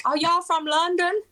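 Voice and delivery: high, bright mock American tourist asking; imitating Americans. Americans in the States asking the British guest, mock American voice. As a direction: lightly send up an American guessing London. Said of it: "people are like".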